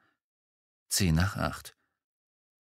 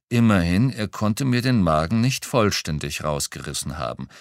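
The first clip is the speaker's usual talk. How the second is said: plain reading voice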